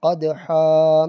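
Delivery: قَدْحًا said slowly as qadha, one fatha, stopping on the vowel; pausal form demo. Shown as a demonstration. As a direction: stretch قَدْحًا slowly and stop on a single fatha. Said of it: "ایک زبر پڑھیں گے".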